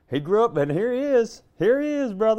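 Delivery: high-pitched, showy hillbilly presentation; hammed-up character voice. Friend playing Ernest's grown son for a laugh, hammed-up high voice. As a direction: present him with a high, swooping flourish.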